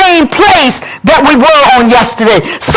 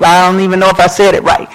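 normal talking voice

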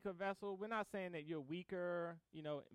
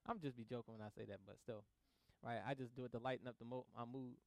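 quick quiet aside; 8 semitones lower, 9 dB quieter, faster than usual. Real, not acted.

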